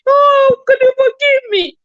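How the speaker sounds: hammed-up weepy begging for forgiveness; mock crying plea. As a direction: mimic a politician's put-on tearful apology. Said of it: "You were pretending".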